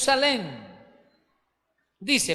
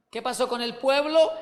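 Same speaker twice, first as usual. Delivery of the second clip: ordinary delivery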